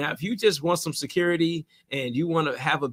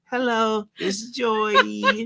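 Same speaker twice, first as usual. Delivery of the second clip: high, slow, sugary hello; sweet phone greeting; 4 semitones higher, much slower than usual. Retold or imitated. Imitating coworker Joy answering a caller sweetly. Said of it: "She answered the phone so sweet".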